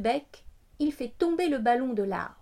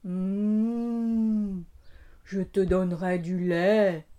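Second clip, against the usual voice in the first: cow voice; 5 semitones lower, much slower than usual. A narrator's reading. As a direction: voice a placid cow. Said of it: "répond la vache".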